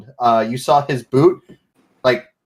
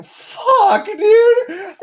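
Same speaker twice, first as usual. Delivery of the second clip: very high, mock-distressed squeal; high-pitched dismay. Game master voicing Finley's reaction, high mock dismay.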